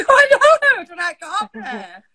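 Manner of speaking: high, loud, helpless giggling; real tearful laughter; laughing through tears. Real, not acted. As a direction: laugh it out, eyes streaming. Pepper in eye, laughing.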